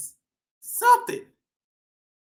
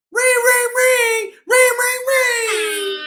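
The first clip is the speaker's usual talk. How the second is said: much higher ringing chant; sing-song doorbell; 10 semitones higher, 3 dB louder, slower than usual. Acted. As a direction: ring like a bell. Imitates doorbell.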